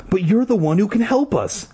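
restrained narrator's scream; mock scream. A narrator's reading. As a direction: plead with rising panic, pitched high. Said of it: "Ashley screamed".

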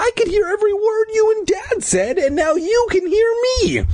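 high, crying protest; tearful protest. A narrator's reading. Scared boy protesting.

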